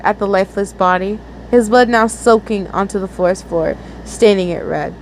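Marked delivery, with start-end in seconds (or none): none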